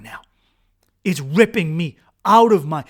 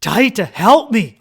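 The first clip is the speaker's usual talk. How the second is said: loud, higher plea for help; acted desperate call; mock begging scream; 4 semitones higher, 8 dB louder, slower than usual. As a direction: cry out for help, short of screaming. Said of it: "have to fucking scream and beg".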